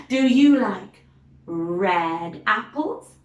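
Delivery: ordinary delivery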